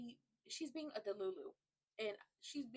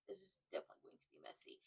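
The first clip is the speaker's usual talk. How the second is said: fast gleeful whisper; whispering to viewers that drama is coming; whispered aside; 10 dB quieter, faster than usual. Real, not acted. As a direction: breathe it like juicy gossip.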